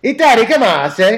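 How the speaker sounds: teasing Dan with a mock Italian accent. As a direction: ham it up like a cartoon Italian. Teasing Dan's Italian take.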